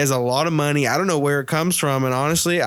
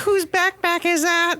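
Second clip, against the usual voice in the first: much higher, slowed mock of a scolding teacher. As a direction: put on a prissy teacher voice to mock her. Teacher voiced in retold story.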